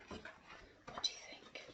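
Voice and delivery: put-on hushed question to kids; whisper. A narrator's reading. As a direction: invite them to guess softly. Asking the children.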